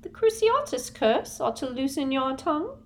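high, prim Umbridge threat; a sugary official's menace. A narrator's reading. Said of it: "said Umbridge quietly".

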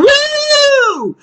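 put-on drunken whoop. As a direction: let out a wild drunk cheer. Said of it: "Drunk, he's like".

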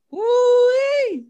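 high delighted whoop; excited whoop. Real, not acted. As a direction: let out a joyful holler. Excited recalling a great game.

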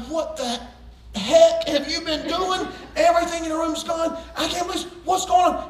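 mock furious dad shouting down the phone; half-real yelling at his son; retold angry yell. Dad yelling at son about the empty room.

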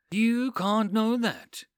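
slow, firm objection; girl contradicting the man, slow and firm; objecting. Acted. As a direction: push back on him. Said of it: "Lisa objected".

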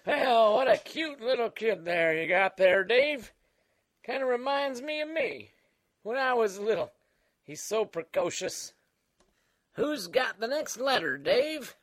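put-on creaky old-timer voice; Old Man Dan. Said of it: "Old Man Dan".

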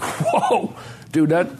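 high-pitched whooping "whoa"; shocked recoil. Retold or imitated. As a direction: yelp it out, mock-stunned, very high. Acting out his shocked reaction.

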